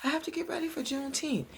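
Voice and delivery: high mock scream without volume. Mother protesting after the shot, high mock scream. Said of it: "And she's screaming".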